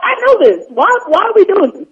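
high, loud mock exasperation; mad, retold. Student complaining about easy alphabet lessons, exasperated. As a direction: question it in exaggerated high-pitched frustration. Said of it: "I was so mad".

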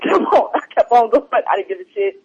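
usual speaking voice

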